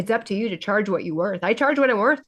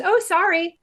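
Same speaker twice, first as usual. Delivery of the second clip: high, quick oh sorry; mock apology; 8 semitones higher than usual. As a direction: imitate the shopper apologizing. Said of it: "she goes".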